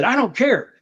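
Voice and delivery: high, heated outburst; snapping in rage. Retold or imitated. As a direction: snap like he's lost it. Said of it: "he snapped and he said".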